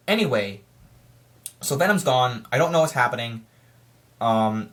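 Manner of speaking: normal talking voice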